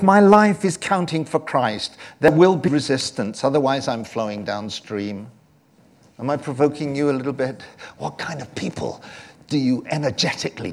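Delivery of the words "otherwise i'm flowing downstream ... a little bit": ordinary talk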